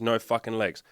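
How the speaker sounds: normal talking voice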